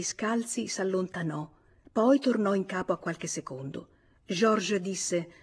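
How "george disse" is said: usual speaking voice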